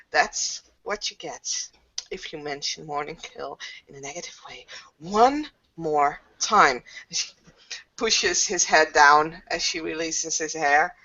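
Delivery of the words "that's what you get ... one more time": menacing low threat. Acted. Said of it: "And she's like".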